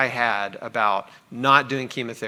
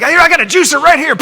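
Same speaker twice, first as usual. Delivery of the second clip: loud high cheering doctor joking; mock pumped-up doctor; 13 semitones higher, 12 dB louder, faster than usual. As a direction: ham it up like an overjoyed party bro. Mock doctor offering juicer.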